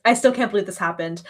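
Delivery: normal talking voice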